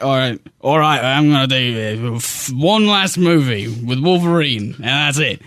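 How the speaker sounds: put-on light Australian accent as Hugh Jackman; mock Australian accent. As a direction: give it a casual Australian accent. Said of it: "for that performance".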